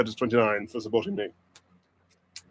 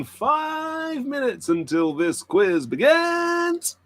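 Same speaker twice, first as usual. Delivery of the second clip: slow, high, booming countdown call; drawn-out announcer call; 11 semitones higher, much slower than usual. Pretend. Host announcing quiz start, slow booming call. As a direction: stretch it out like a ring announcer. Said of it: "countdown call in put-on voice".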